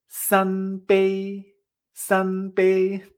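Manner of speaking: SAN PEI en una sola nota sostenida; tono robot. Shown as a demonstration. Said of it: "tono robot". («san pei» monótono, plano y robótico)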